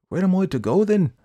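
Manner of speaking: high, loud plea; dismayed Jack. A narrator's reading. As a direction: plead in confusion.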